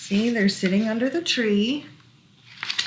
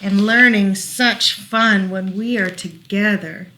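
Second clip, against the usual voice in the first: lilting rhyming read-aloud; reading to children; 2 semitones higher, 3 dB louder than usual. Acted. Parent reading a rhyming book to child, gentle lilt. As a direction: read the rhyme gently to a toddler.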